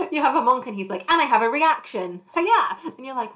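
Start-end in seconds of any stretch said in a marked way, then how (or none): 1.1-2.8 louder, jumping higher on the battle cry; mock eager player. Monk's player interrupting combat, gleefully eager. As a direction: announce it proudly, then a karate yell.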